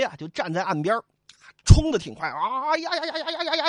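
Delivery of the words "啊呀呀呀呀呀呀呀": high, long battle yell; charging war cry. Acted. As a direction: charge in yelling. (冲锋时的高声怪叫)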